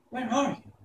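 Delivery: put-on a child calling out; Dorothy.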